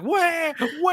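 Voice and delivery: high mock baby cry; imitated baby wail. Imitating the baby crying in the theater, high wail. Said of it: "the baby's literally just like".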